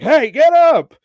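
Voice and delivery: sudden high, loud wake-up shout; retold yell at a sleeping friend. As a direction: bark him awake. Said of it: "yells at him".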